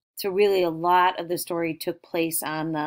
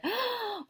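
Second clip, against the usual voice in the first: sharp, high mock gasp of fright; frightened gasp; 7 dB quieter than usual. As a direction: gasp as a little goat startled by a troll. Littlest goat reacts to troll.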